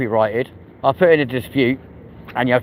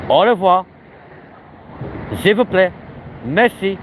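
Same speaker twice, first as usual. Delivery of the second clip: higher, sing-song French au revoir; higher, playful mock French; hammed-up French phrases; 6 semitones higher than usual. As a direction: toss out French words with flair. Showing off French.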